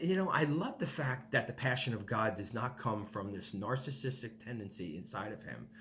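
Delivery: normal talking voice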